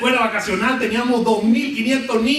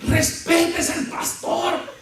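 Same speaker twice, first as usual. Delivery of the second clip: offended church lady; 5 semitones higher, much slower than usual. Retold or imitated. Offended sister scolds child.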